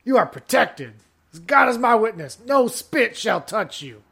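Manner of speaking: loud hammed-up southern casino pitchman; southern accent. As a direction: sell it like a brash Texas promoter with a southern accent. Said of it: "just has a southern accent".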